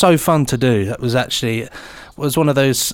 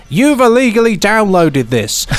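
higher, louder scolding voice-over; mock recorded warning; 6 semitones higher, 4 dB louder than usual. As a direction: play the stern anti-piracy recording. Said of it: "a recording of himself saying things like".

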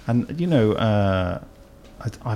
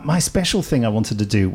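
ordinary talk

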